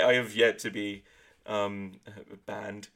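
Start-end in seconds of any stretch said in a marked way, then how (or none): none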